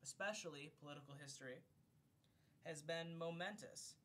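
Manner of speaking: formal book-reading voice; reading aloud. Acted. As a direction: read it like an audiobook narrator. Reads a book foreword aloud.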